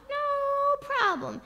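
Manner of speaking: high, very long first word; drawn-out reassurance. Retold or imitated. Father coaxes child about burning mole, long high crooning. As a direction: stretch NO right out, up high.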